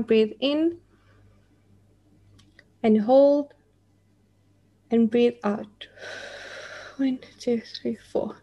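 counting breaths, trailing to a hush; slow breath counting. Shown as a demonstration. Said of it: "let's just do that one last time".

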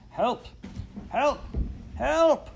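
high, rising alarm cries; crying for help. A narrator's reading. Panicked dog to sleeping master, crying for help. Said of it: "cried Garm".

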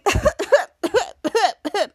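repeated fake coughs; a fit of staged coughing; acted coughing. Natalie coughs by the sparklers, acted coughing. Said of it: "Natalie coughing".

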